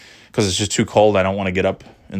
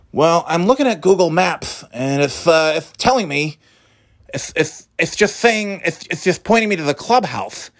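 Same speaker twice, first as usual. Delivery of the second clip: higher, halting insistence; lightly voiced driver; 8 semitones higher than usual. Delivery woman insists to the narrator in a higher voice. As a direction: stammer through the map excuse.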